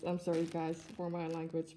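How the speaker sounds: ordinary talk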